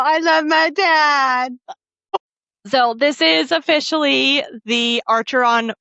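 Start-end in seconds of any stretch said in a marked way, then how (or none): 0.0-1.6 mock tearful sob. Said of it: "I'm over here all".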